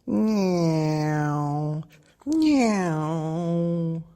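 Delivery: high, loud droning plane sound; aeroplane noise. Acted. Baby Bear playing aeroplane in bed, high droning noise. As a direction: zoom like a toy plane. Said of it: "went Baby Bear".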